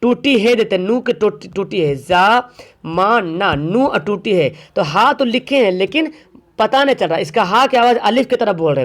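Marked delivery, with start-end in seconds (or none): 2.0-3.5 जा मा ना spelled out slowly, each syllable apart. Shown as a demonstration. Teacher to students, slowly spelling out जा मा ना. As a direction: break जा मा ना into slow separate beats. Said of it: "ऐसे लिखते हैं ज़माना".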